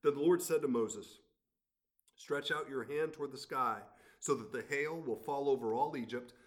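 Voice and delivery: lifted, measured Bible reading; scripture reading. Acted. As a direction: read God's words to Pharaoh with weight.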